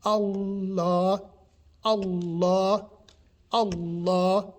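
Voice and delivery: "Allah" with a long held L and long A; drawn-out "Allah", L doubled; emphasised lam, elongated A. Shown as a demonstration. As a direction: lean on the L, then stretch the end of "Allah". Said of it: "we put an emphasis on the lam".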